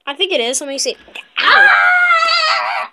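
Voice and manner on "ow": high, loud drawn-out "ow"; yelp of pain. Real, not acted. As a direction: yelp loudly and hold it. Playful hurt yelp.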